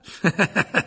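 high, showy chuckle; high put-on laugh; self-satisfied laugh. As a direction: titter smugly on the way out. Lockhart leaves, pleased with himself.

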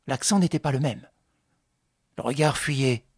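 ordinary delivery, nothing marked

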